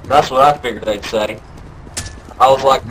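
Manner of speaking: usual speaking voice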